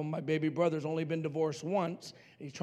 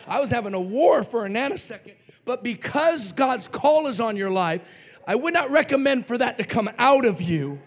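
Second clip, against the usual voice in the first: preaching at full force; 5 semitones higher, 9 dB louder than usual. Acted. Preacher pressing the lesson on his congregation, loud and emphatic. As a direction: drive the point home to the church.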